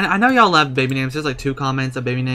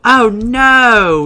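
surprised cry; 9 semitones higher, 10 dB louder than usual. Real, not acted. Reacting to sim's action.

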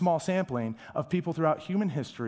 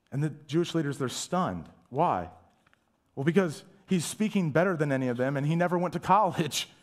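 normal talking voice

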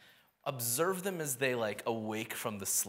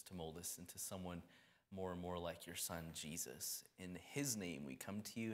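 soft, low-pitched prayer; hushed, reverent congregational prayer; 5 semitones lower, 6 dB quieter than usual. Real, not acted. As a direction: pray gently, trailing softer as you go. Leads an opening prayer.

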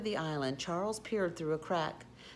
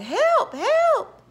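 repeated high, loud screams; frantic drowning yells; panicked cry; 12 dB louder than usual. A narrator's reading. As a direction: shriek for rescue, each cry urgent. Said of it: "he cried".

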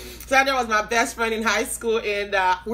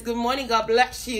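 ordinary delivery

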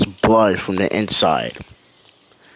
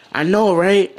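put-on excited girl; 8 semitones higher, 3 dB louder than usual. Nami excited reply.

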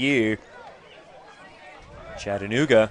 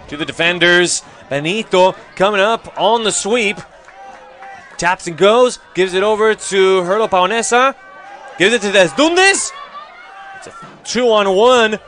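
building, louder and higher play-by-play; rising excitement; 6 semitones higher, 5 dB louder than usual. Real, not acted. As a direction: ramp up as the attack develops. Attack builds to a goal.

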